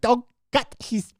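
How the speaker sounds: queen impression slipping into German, high and slow; high-pitched, drawn-out German-accented queen; accidental German accent. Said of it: "I don't know why she's German".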